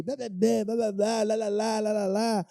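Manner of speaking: rhythmic imitation of a rant, evenly pitched; mock telling-off. Churchgoer chewing out a motorcyclist, in mock rant. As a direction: rattle off a wordless pretend scolding. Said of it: "descasquei o cara, falei, xinguei".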